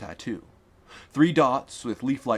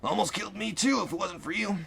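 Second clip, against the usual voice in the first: weak laugh; 10 semitones higher than usual. A narrator's reading. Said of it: "H laughed weakly".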